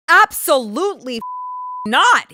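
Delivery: high, loud, punched-out refusal; emphatic refusal. Retold or imitated. Retelling a reply to a friend's text, emphatic refusal. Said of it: "They texted me, and I'm like".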